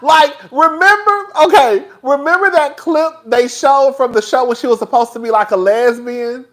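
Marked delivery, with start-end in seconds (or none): none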